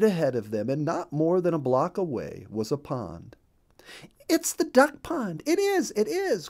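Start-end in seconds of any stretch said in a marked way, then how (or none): 4.3-6.5 very high, thrilled squeal of relief; excited. A narrator's reading. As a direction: burst out with joy at the pond. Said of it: "quacked Missus Mallard excitingly".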